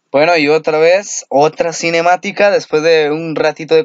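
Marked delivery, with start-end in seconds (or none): none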